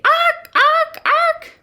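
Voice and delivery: loud, high barking squawks; jay squawk. Shown as a demonstration. Showing children the favourite jay noise, loud high squawking. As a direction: squawk high, a bit like a seal. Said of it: "my favorite was making the J".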